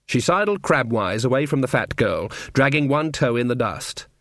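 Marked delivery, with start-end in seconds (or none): none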